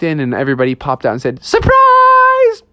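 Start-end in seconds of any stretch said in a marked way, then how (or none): 1.4-2.6 loud, very high drawn-out cheer; excited party shout. Acted. Ghosts popping out at a party, loud high shout. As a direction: yell it like a crowd jumping out.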